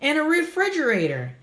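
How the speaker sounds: put-on high, excited little girl's voice; playful delight at the clouds; a happy kid spotting shapes; giggly girl. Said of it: "Gertie giggled".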